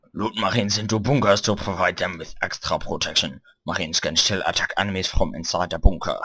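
English read in a put-on German accent; heavy mock-German delivery. Shown as a demonstration. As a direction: read the instructions like a stern German. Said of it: "pronounce it German".

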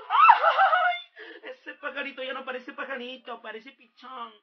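mocking wife. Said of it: "te dice".

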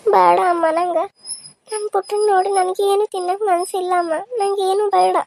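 high, whimpering protest; tearful refusal. Acted. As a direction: turn down food through tears. Upset mother refuses food. (ಅಳುದನಿಯಲ್ಲಿ ನಿರಾಕರಣೆ)

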